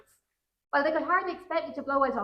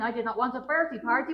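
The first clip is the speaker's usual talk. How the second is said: usual speaking voice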